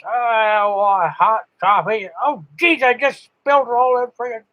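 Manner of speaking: high, mock-flustered old guy spilling coffee; imitating clumsy operator. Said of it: "Then he says".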